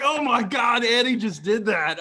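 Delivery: high, loud excited disbelief; amazed. Retold or imitated. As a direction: gush with thrilled disbelief. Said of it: "I was like".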